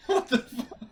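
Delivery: a high, loud, laughing "what the fuck"; real shocked laugh at the huge number; laughing disbelief. Real, not acted. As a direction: burst out in amazed laughter. Hears Hank has nine talents.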